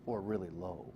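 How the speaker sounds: ordinary talk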